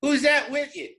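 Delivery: high suspicious country voice; Jesse John asking. Retold or imitated. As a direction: squint at the stranger and ask. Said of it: "he says".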